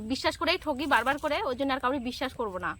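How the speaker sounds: ordinary delivery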